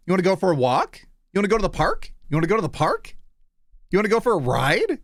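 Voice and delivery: put-on high excited baby talk to a dog; squeaky praising a dog. Shown as a demonstration. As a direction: coo at a puppy, all rising questions. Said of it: "I'll do one for the dogs".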